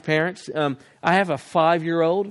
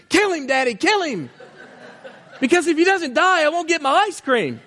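put-on child screaming; 11 semitones higher than usual. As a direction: shriek it like a greedy kid, laughing through it. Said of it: "he begins to scream".

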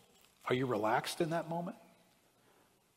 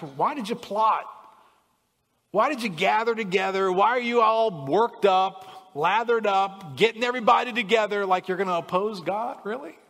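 louder, higher, rhetorical rebuke; indignant challenge; 7 semitones higher, 8 dB louder than usual. Acted. God questioning the kings who plotted, loud rebuke. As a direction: demand it of the plotters.

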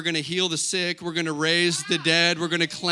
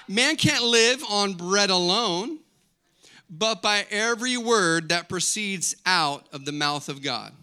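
slow, measured proclamation; scripture recitation; 3 semitones higher, slower than usual. Real, not acted. Pastor quoting scripture to the congregation, slow recitation. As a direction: draw out each phrase of the verse.

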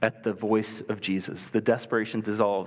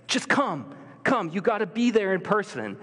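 desperate plea; 8 semitones higher than usual. Retold or imitated. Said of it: "the official's like".

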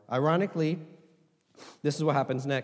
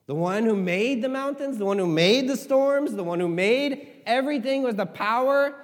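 normal talking voice

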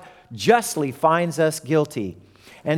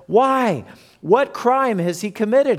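a higher, pleading Pilate; Pilate asking; 6 semitones higher than usual. Acted. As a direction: ask it as the puzzled governor. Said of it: "asked Pilate".

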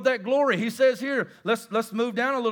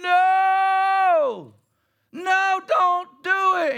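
high, loud drawn-out wail of protest; screaming flesh; 9 semitones higher, 5 dB louder, much slower than usual. Acted. The preacher voices the flesh resisting giving, screaming. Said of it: "your flesh is screaming".

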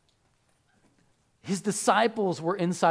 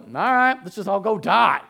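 mock chipper; 3 semitones higher, 6 dB louder than usual. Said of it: "chipper voice in the background".

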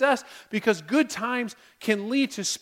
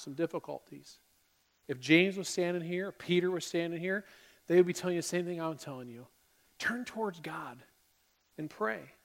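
much softer and lower, sincere; hushed, earnest appeal; 4 semitones lower, 9 dB quieter than usual. Real, not acted. Preacher to hurting listeners in the congregation, hushed earnest appeal. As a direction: drop down low and gently urge them.

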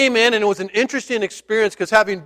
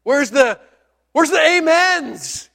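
bewildered; 8 semitones higher, slower than usual. Retold or imitated. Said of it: "I sat back going".

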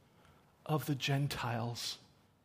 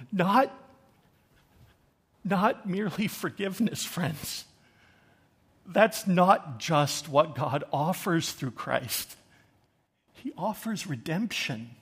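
halting, overcome with emotion; real catch of emotion mid-sermon; choked up; 3 semitones higher, 4 dB louder, slower than usual. Real, not acted. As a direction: preach through a lump in the throat, with long pauses. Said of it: "I get a little choked up".

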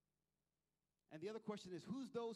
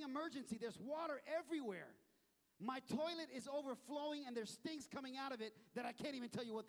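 higher, louder mock-desperate homeowner; frantic plea; 5 semitones higher, 3 dB louder than usual. Acted homeowner begging plumber for help.

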